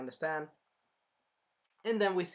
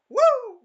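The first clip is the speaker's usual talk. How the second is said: high, loud "woo"; comic flying whoop; 14 dB louder than usual. Retold or imitated. Parents kicking daughter off, high comic whoop. Imitates daughter being kicked off.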